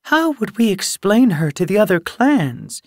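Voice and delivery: scandalised, high-pitched disbelief; shocked. A narrator's reading. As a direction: protest in shock, pitch soaring. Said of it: "Meadowslip sounded shocked".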